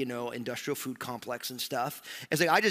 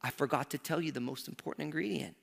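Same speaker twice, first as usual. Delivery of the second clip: softer, tender and knowing; smiling grandma; 3 semitones lower, 7 dB quieter than usual. Retold or imitated. Grandma gently reveals the secret ingredient, tender. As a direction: let the smile into the voice, soft. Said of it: "she got a big smile on her face".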